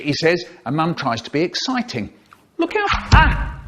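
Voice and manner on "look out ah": high-pitched warning cry; high mum. Acted. Mum warning the family at the table, high. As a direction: squeak out the warning.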